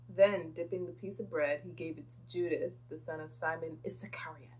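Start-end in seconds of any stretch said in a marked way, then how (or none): 2.9-4.5 breathy whisper. Real, not acted. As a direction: drop to a whisper. Whispered aside.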